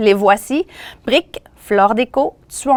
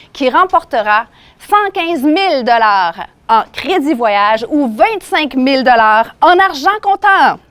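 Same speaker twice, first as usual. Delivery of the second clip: ordinary delivery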